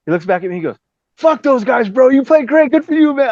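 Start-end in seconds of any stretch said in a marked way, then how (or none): 1.2-3.3 high-pitched imitation of an excitable bandmate; imitated buddy's pep talk; hyped-up encouragement. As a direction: cheer him on in a high put-on voice. Marco consoling him after audition.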